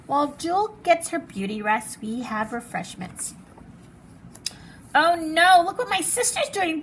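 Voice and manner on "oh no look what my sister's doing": alarmed. Acted. Sister upsets the dog.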